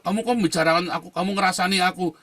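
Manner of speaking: high, loud mock outrage; angry retort. Preacher retelling a man accusing him of gossip, angrily. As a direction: imitate an offended man's angry challenge. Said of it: "malah marah-marah dia".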